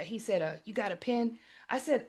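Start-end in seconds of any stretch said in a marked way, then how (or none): 0.4-1.4 softly voiced, offhand dad; casual request, voice well down; unimpressed dad. Retelling her unimpressed hustler dad.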